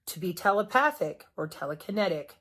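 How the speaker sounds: ordinary talk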